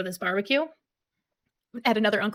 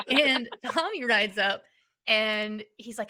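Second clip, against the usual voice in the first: ordinary talk, nothing marked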